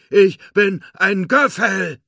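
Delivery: very high, loud, slow character voice; squeaky, drawn-out proud announcement; triumphant proclamation. A narrator's reading. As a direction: declare your identity at the top of your voice. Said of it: "verkündete Forky".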